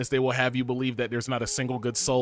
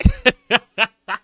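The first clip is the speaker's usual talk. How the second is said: loud laugh; 8 semitones higher, 10 dB louder than usual. Real, not acted. Laughs after asking chat.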